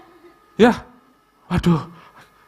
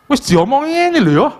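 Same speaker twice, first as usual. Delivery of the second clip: impatient explaining; 12 semitones higher, 4 dB louder than usual. Retold or imitated. Son explaining internet banking to father.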